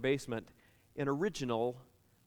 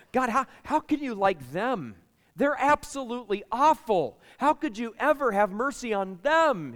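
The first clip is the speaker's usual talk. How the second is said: higher, louder protesting Jonah; indignant Jonah; 7 semitones higher, 4 dB louder than usual. Acted. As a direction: protest in disbelief at God's mercy. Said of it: "And Jonah says".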